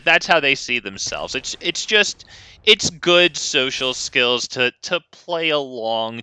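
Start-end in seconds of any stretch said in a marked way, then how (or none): none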